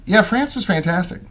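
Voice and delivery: ordinary delivery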